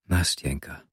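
put-on a quiet, soothing call to a weeping girl; soft, coaxing. A narrator's reading. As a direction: coax her name out gently. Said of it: "begann ich mit leiser, einschmeichelnder Stimme".

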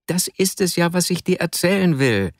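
high-pitched, strained-sounding protest; protesting Vasja. A narrator's reading. Pinned friend answering Arkadi, high-pitched protest.

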